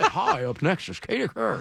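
mock anchor hand-off, mouth full; Tom Brokaw. Host introduces the next anchor in jest, Brokaw voice. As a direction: play the mumbling newsman. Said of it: "hot potato voice is my Tom Brokaw".

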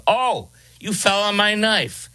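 high, drawn-out sarcastic disbelief; mock incredulity. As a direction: ridicule an absurd excuse, slow and high-pitched. Mocking the defense's impalement claim.

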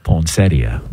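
"poinsettia" low, with an Italian-style PON start; own odd pronunciation. Shown as a demonstration. Repeating "poinsettia" for the co-hosts on request, Italian-style and low. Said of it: "Say it how you said it".

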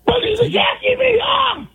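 strained hoarse shouting; hoarse yell. Real, not acted. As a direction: yell through a ruined voice. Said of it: "Hurts my voice just listening".

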